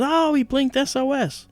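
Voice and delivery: high, slow, delighted exclamation; tickled doctor announcing the joke; retold doctor's laughing surprise; amused surprise. Doctor laughs at SOS blink.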